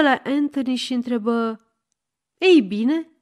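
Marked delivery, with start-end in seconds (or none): 2.4-3.1 a louder, higher threatening challenge; the old shopkeeper demands it, menacing. A narrator's reading. Old shopkeeper challenges Anthony, menacing and louder. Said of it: "cu o voce amenințătoare".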